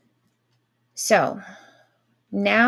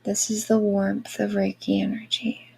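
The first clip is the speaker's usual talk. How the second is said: airy breathy mystical healer. Acted. Guided Reiki meditation.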